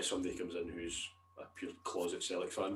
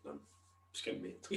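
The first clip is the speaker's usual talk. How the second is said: ordinary talk, nothing marked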